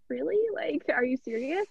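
retold disbelief, voice lifting in pitch; disbelieving. Athlete asking her coach if she means it, disbelieving.

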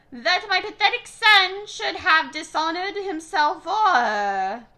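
put-on loud, high scolding old woman; ranting grandmother. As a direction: rant at her, shrill with contempt. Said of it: "her grandmother's ranting".

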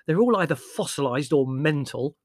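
despairing. A narrator's reading. Larry complaining about the relatives, despairingly. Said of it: "asked Larry despairingly".

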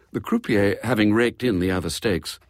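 plain reading voice

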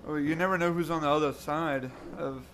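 usual speaking voice

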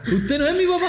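put-on high, timid child asking a stranger; a lost boy asking the wrong man; confused child. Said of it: "Entonces le digo".

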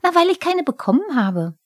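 a goblin sulking that the cat gets carried; Pumuckl the kobold, jealous. Acted. Pumuckl quengelt.

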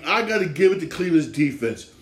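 ordinary delivery

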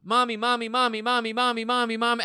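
rapid high monotone chant, imitating a nagging child; pestering toddler. Mother mimics her daughter calling her nonstop, pestering toddler. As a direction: repeat it nonstop on one high note like a toddler. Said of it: "she'll literally be like".

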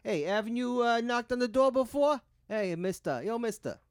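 put-on high, loud Brooklyn street accent. Co-host shows a Brooklyn accent for the joke, hammed-up Brooklyn. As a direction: ham up a Brooklyn tough guy. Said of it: "a Brooklyn accent can so".

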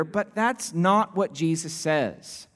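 usual speaking voice

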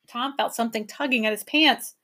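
ordinary delivery, nothing marked

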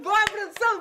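high, loud playful yelling; loud joking shout. Acted. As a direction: shout it out, teasing. Shooing off the crew, joking.

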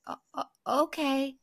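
high, faltering stutter; stammering timid okay. Retold or imitated. Wife after being snapped at, high stammering meekness. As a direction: stumble over it, hurt and meek. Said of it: "she's just like".

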